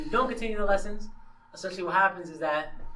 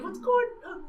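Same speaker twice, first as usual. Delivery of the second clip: high startled whine; 12 semitones higher than usual. Retold or imitated. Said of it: "he's like".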